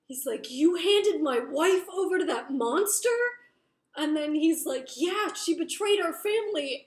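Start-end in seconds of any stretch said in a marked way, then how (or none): 0.5-3.1 loud, higher incredulous fury, acted out; going postal. Jace confronts brother about his wife, loud acted outrage. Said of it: "goes postal". 4.9-6.8 loud, higher retold comeback; acted angry retort. Brother justifies betraying her to Jace, loud angry retort.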